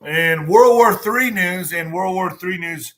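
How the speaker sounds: slow, loud announcer intro; news announcer. Acted. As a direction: boom the headline like a newsreader. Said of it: "announcer opening".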